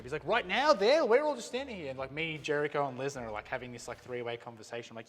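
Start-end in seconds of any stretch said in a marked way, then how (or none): 0.3-1.9 high, loud retold disbelief; incredulous protest. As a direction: imitate a colleague exclaiming in astonishment. Voicing Jericho's disbelief at filming.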